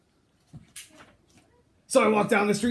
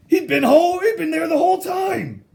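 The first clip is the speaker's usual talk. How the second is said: surprised; 8 semitones higher, 4 dB louder than usual. Acted. Shocked find of the pig.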